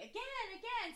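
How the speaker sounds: high-pitched, eager kid imitation; excited child. Daughter begging for another bumpy ride, excited imitation. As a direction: beg like a thrilled little child. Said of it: "Katie got all excited".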